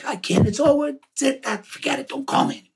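airless whispered rasp of a lost voice; hoarse whisper. Retold or imitated. Son imitating his voiceless father's whisper. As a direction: imitate a man with no voice, all breath. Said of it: "it sounded like this".

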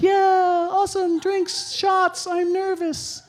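high, jittery excited babble; nervous excitement. Retold or imitated. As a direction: gush nervously like a seventh grader.

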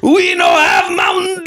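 high shouted broken-English Italian; angry Italian shopkeeper. Retold or imitated. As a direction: bellow it like an offended owner. Italian owner voiced.

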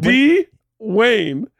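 high, slow teasing of the name; teasing spell-out. Pretend. Friend splits the name Dwayne, high mocking. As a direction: break the name apart mockingly. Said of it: "What a weird name".

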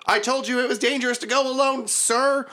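high put-on gnoll voice calling out; yelling gnoll. The gnoll calls to Hambone, high and frantic. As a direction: squeak it out as a frantic little gnoll. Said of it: "and he yells".

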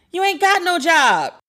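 high, slow, incredulous callout; sassy retort. Retold or imitated. Retorting to her friend.

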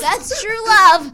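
loud, high, drawn-out mock declaration; mock swooning. Host teasing guest about his fart story, mock swooning. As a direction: belt it out slowly, way up high.

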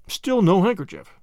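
usual speaking voice

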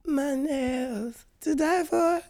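high, slow, airy influencer drawl. Retold or imitated. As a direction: stretch it out like a bored influencer.

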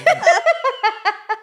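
squeaky burst of laughter; high laugh. Real, not acted.

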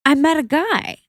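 high, excited mom announcement; imitating mother.

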